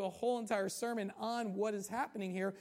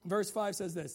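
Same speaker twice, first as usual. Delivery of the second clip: ordinary delivery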